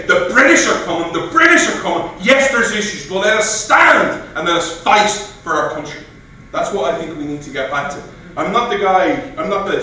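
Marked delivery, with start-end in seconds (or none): 0.0-6.0 high and loud rousing call to arms; rally the troops to stand and fight; a defiant battle cry to the town; rallying Paul Revere. Retold or imitated. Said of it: "Or did he say".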